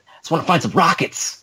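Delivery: high-pitched movie impression; acted-out film quote; quoted movie line. Quoting a film character from the movie.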